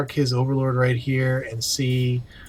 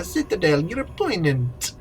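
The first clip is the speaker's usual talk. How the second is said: put-on pirate accent; 5 semitones higher than usual. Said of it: "that accent".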